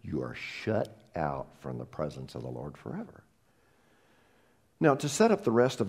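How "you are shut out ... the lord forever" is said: hushed grave warning. Real, not acted. Grave warning after sigh.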